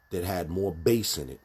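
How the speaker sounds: ordinary talk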